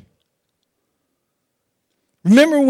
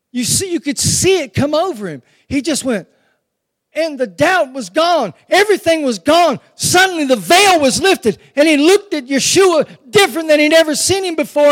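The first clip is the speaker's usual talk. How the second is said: louder and higher, preaching hard; rising excitement; 4 semitones higher, 3 dB louder than usual. Real, not acted. Preacher building the congregation up to Peter's revelation, shouting.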